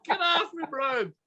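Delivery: high, slow laughing push-off; laughing protest. Pretend. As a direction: giggle while fending him off. Acted struggle against zombie bite.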